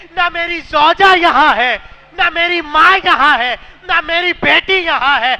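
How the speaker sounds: loud tearful cry of a lonely man. A narrator's reading. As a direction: wail the lines as a weeping stranger. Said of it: "رو کر کہنے لگے".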